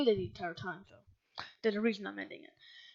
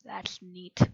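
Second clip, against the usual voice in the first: softer, mock indifferent aside; quiet, unimpressed imitation; unbothered; 3 semitones lower, 13 dB quieter than usual. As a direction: shrug it off like nothing's wrong. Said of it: "but they're like".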